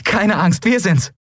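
high, happy reassurance; jubilant. A narrator's reading. Endriel telling nervous Miko it's them, jubilant. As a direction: reassure joyfully, pitch well up. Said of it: "jubelte Endriel".

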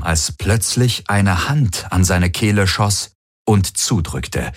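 plain reading voice

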